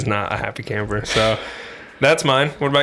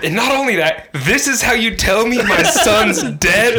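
imitating an outraged mother, much higher and slow; hammed-up furious mother, pitched up and laughing; mock indignant mom; 12 semitones higher, 4 dB louder, slower than usual. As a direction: drag it out like a scandalized mom. Mom berating the prank caller.

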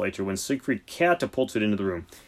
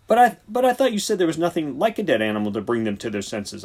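querulous; 5 semitones higher, 4 dB louder than usual. A narrator's reading. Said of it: "I said querulously".

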